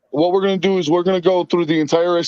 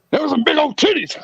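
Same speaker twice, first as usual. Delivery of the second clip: higher, sudden blurted tic voice; imitating a tic blurt; 9 semitones higher than usual. Retelling a girl's Tourette's tic, higher mock blurt. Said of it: "And she's like".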